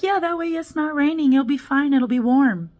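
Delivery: high, sugary mock cop reassurance; imitating the cop. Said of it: "They're like".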